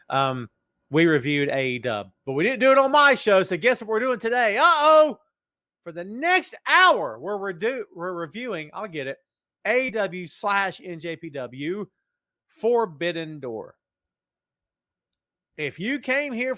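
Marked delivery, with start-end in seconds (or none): 2.3-5.2 much higher and louder, building to a squealed uh-oh; excited hype. Real, not acted. Host hypes viewers for an hour of review, excited and loud. 5.8-13.8 announcer. Acted. Announcing the show's topic.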